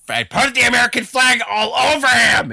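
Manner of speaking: loud, high, over-the-top yell; mock shout. Podcaster mimicking gung-ho flag-covered artwork idea, shouting. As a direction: belt it out at full volume, high-pitched.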